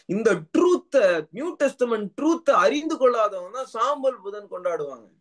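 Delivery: high-pitched, driving sermon delivery; impassioned preaching. Real, not acted. Preacher to congregation on resurrection, impassioned preaching.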